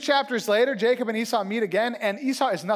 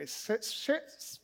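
normal talking voice

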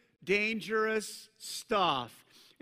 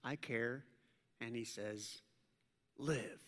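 tender; 6 semitones lower, 8 dB quieter, slower than usual. Acted. God's tender word to the discarded.

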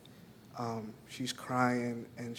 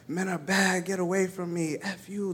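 higher, mock screaming child's voice; screaming little girl; 6 semitones higher than usual. Teacher retells the little girl screaming as he carries her, higher mock scream. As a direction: play the upset five-year-old pushing him away. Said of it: "And she's screaming".

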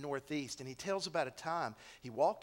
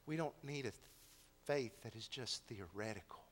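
ordinary delivery

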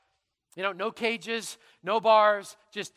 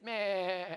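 imitated lamb bleat, drawn out; lamb's bleat; 3 dB quieter than usual. John gets a lamb instead, comic bleat. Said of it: "instead he gets".

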